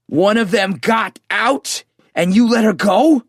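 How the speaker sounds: high, slow, furious roar; roaring disbelief. Acted. Andy roaring at the narrator who let her go. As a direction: bellow it in outraged disbelief. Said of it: "he roared".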